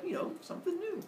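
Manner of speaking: high, soft sheepish voice; bashful high mom voice; coy. Retold or imitated. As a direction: say it shyly, high up. Mother Earth coy reply.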